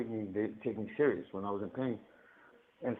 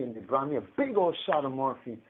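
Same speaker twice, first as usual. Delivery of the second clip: usual speaking voice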